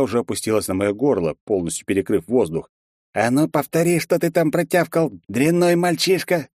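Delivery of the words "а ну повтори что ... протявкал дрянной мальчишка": cold hissing lizard voice. A narrator's reading. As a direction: hiss the threat through his teeth. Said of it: "прошипел он".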